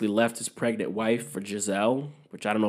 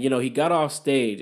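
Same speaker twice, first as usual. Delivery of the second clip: normal talking voice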